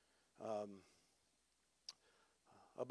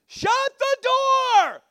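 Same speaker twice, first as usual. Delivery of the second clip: long, high-pitched holler; neighbor's yell; 14 dB louder, much slower than usual. Retold or imitated. Woman yelling down the street at a stranger, loud drawn-out holler. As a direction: bellow it drawn out across a distance.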